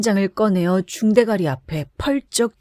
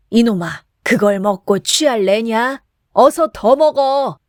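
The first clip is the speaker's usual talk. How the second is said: slowing, louder bellow; drunken shout; 3 semitones higher, 4 dB louder than usual. A narrator's reading. Drunk Kim yanks Chisam's ear, bellowing. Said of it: "취한이는 부르짖었다".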